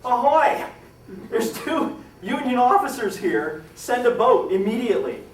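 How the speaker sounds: loud, high hail across the water; Loring hailing the gunboats in a storm, loud yell. Retold or imitated. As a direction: shout for a boat over the storm. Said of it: "and Loring yells out".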